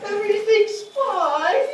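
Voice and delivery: high, loud, sulky brush-off; sulky fine. Retold or imitated. Person sulking at someone who asks, high and loud. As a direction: snap it out, clearly not fine. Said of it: "And we go".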